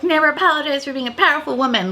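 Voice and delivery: mock the cheesy slogan, rushed and sing-song; mocking girlboss slogan. Reviewer mocks the palette slogan, in a fast hammed-up voice. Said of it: "when you're like".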